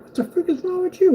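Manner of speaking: a hammed-up nagging wife; annoyed wife. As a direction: squeak it like a fed-up wife. Wife voiced in a story.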